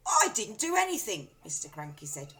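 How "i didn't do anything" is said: high, loud denial; innocent husband. A narrator's reading. Husband denying blame to wife. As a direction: protest innocence.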